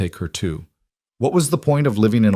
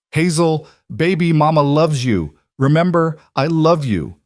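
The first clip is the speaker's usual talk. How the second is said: calling out; 7 semitones higher, 5 dB louder than usual. A narrator's reading. Mother calling after the wagon.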